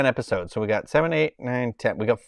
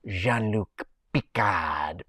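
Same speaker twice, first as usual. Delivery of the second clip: very slow, drawn-out mock French accent; imitating the villain's hammed-up French accent; intense French accent; 3 semitones lower, 5 dB quieter, much slower than usual. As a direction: stretch out Jean-Luc Picard in an overdone French accent. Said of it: "the most intense French accent you could ever do".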